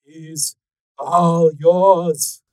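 menacing handover. Acted. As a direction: announce it grandly to a waiting mob. Hands victim to his gang.

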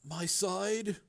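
soft, high, uncertain question. A narrator's reading. Brother echoing Frank, quiet uncertain voice. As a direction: echo it quietly, unsure. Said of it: "echoed in a quieter voice".

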